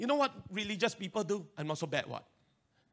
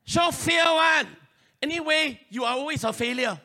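very loud voice; 6 semitones higher, 10 dB louder than usual. Retold or imitated. Preacher shouting the inner voice that says you fail. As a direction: yell the doubts like a heckler. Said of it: "that voice that very loud".

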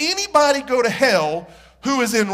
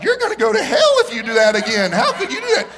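mock rage; 7 semitones higher, 3 dB louder than usual. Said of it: "you look at 'em like".

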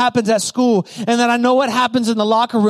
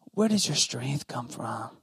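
Delilah coaxing; 10 semitones lower, 12 dB quieter than usual. Acted. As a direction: draw out the secret softly. Delilah coaxing Samson for his secret.

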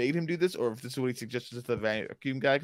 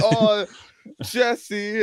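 much higher and louder "Oh, Jesse"; a fan thrilled to spot Jesse; mock excited squeal; 9 semitones higher, 7 dB louder than usual. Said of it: "It went from".

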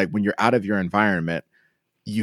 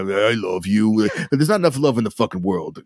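Barney voice. Retold or imitated. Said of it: "They go".

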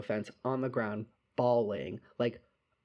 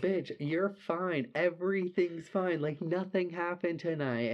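higher-pitched retold reassurance; exasperated reassurance; 6 semitones higher than usual. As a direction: insist to a crying friend that all is well. Retold reassuring of crying friend.

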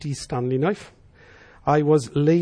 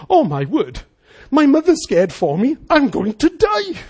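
imitating scared child; 8 semitones higher, 5 dB louder than usual. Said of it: "he's there thinking".

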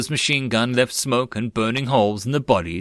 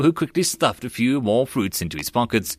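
ordinary delivery

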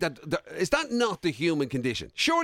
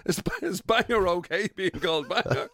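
laughing question; 4 semitones higher than usual. Real, not acted.

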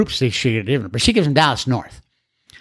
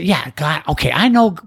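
higher, brushing-off voice of a doubting newcomer; dismissive skeptic; 7 semitones higher than usual. Retold or imitated. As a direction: play the guy who shrugs God off as if it's obvious. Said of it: "And so the guys would say".

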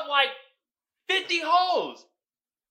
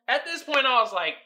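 normal talking voice

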